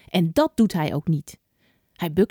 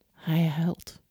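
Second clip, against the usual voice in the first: hushed whisper; 3 semitones lower, 4 dB quieter than usual. A narrator's reading. Petrus weeps after his denial.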